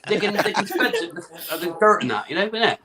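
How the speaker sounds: comic southern English farmer accent; hammed-up rustic farmer accent; West Country farmer accent. Shown as a demonstration. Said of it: "how do you do a farmer?".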